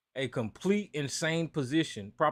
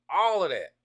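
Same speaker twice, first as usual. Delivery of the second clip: usual speaking voice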